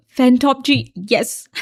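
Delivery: ordinary delivery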